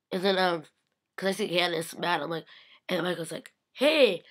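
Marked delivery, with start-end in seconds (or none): 3.7-4.2 high, loud "Hey!"; loud greeting call. Retold or imitated. Michael greeting her from a distance, a loud high hey. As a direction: call out across the room.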